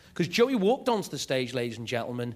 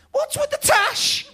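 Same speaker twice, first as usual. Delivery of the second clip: high, slow, loud mocking taunt; bratty Scouse bride; 5 dB louder, slower than usual. Young bride taunting Saddam, high slow shout. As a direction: sneer it down at him from way up high. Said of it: "her key opening gambit".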